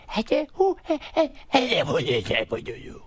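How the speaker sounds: high-pitched nonsense comic babble; Adam Sandler. Acted. Second phase of the comic's act, high silly babble. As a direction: leap up into silly squeaky gibberish. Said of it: "then he does the thing".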